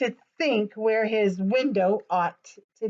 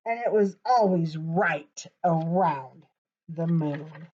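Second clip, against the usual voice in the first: plain reading voice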